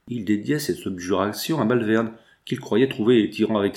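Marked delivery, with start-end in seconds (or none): none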